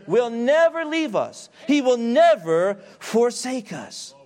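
full-force preacher crescendo; preaching at full force. Acted. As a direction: build the list of God's names to a shout. Preaching climax.

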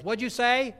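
high, loud call from upstairs. Retold or imitated. Wife upstairs calls to her husband, calling out. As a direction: imitate a wife hollering a question.

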